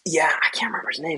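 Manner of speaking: real whisper, fast, to himself; quick private whisper; whispered aside. Real, not acted. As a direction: mutter it under the breath while searching for a name. Aside while blanking on name.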